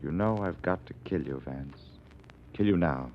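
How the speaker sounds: low, soft threat held at gunpoint; quiet menace. Acted. Killer quietly threatens the detective, low menace. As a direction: murmur the threat coldly, hand on the gun.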